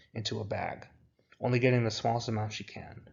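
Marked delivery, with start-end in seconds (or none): none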